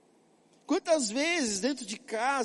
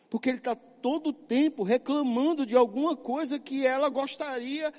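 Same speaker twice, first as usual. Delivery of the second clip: ordinary talk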